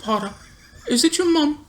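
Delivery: high, soft mocking taunt. Friend teasing about Harry's mom, high mocking voice.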